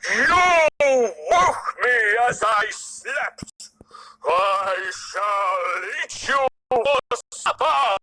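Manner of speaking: slow, loud furious lion growl; angry roar. A narrator's reading. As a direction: roar it at the little mouse. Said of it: "The lion roared in anger".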